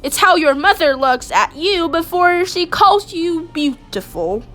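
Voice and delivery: ordinary delivery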